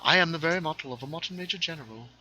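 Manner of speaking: quick, high mock-British patter; hammed-up British accent. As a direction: rattle it off in fake British. Answers accent remark with hammy patter.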